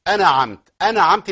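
«أنعمت» with a stretched a after the n; added alif. Shown as a demonstration. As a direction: wrongly break «أنعمت» into ana-amta. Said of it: "ليه تجيب ألف؟". («أنعمت» بألف زائدة بعد النون)

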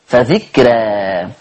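فذكرى stretched long and low; drawn-out dhikra. Shown as a demonstration. Teacher stretches فذكرى explaining the rule. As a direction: say فذكرى very slowly. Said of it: "اصلها ذكرى".

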